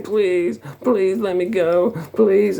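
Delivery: high, drawn-out begging; mock girlish pleading. Beaten troll begging his attacker, mock girlish pleading. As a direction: imitate a little girl begging for release. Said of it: "sounds like a little girl".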